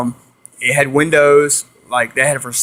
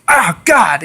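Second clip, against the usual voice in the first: higher-pitched exclamation of alarm; startled yelp; 5 semitones higher than usual. Retold or imitated. Friend nipped by the gator turtle, startled yelp. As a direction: act out getting nipped by a turtle.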